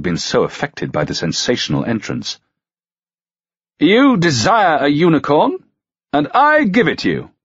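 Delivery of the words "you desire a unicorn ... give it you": jovial, grandly amused, pitched high; lordly good cheer, higher voice; high good humour. A narrator's reading. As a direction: hand it over like a lavish treat. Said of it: "in high good humour".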